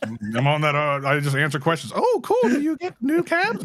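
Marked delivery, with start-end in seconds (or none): 1.9-3.6 very high, gushing fan voice, slower on the question; mock eager fan. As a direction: play a fawning fan who only wants free cabs. Mocking a fan who wants cabs.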